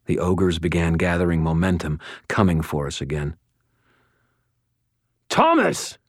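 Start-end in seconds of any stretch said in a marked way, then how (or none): 5.3-6.0 high, urgent shout across battle; shouting a name. A narrator's reading. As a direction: yell for him to come. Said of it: "I shouted".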